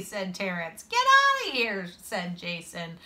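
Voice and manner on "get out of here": high, loud send-off; dismissive order. A narrator's reading. Kid sending boy away from the game, high and dismissive. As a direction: chase him away in a high voice.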